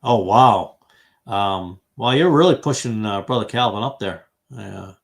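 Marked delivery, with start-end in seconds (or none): none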